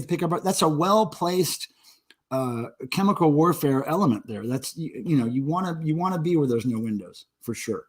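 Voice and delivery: normal talking voice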